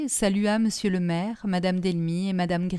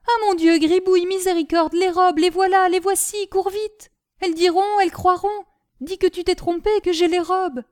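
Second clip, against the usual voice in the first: high, loud imitation of his sister; mimicked panicked cry; 10 semitones higher, 6 dB louder than usual. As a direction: Gribouille replays Caroline's alarm. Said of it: "elle va à l'armoire et crie". (Gribouille imite le cri affolé de sa sœur)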